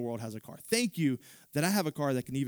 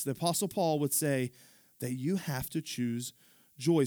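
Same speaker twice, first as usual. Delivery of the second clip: ordinary talk, nothing marked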